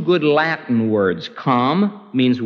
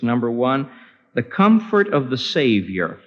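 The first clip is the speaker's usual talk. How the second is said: ordinary talk, nothing marked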